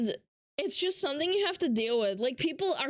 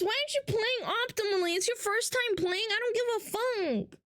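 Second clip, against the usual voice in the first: high, mocking chat messages; a hint of a whiny backseater; mocking chatters; 8 semitones higher than usual. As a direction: rattle off dumb questions in a raised voice. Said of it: "mocking chat messages".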